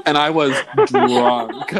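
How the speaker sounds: slow, laughing confession. Real, not acted. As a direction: laugh through the admission. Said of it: "laughing admission".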